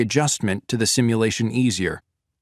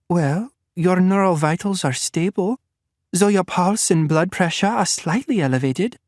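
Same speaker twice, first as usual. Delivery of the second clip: higher clinical woman's voice; doctor's even bedside reassurance; Victoria, doctor; 8 semitones higher than usual. A narrator's reading. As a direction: report the vitals calmly, like a doctor. Victoria reading vitals.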